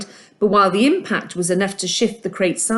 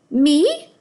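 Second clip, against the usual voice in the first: cringing; 9 semitones higher than usual. A narrator's reading. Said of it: "Socks cringed".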